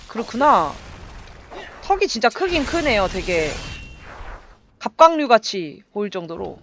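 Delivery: ordinary delivery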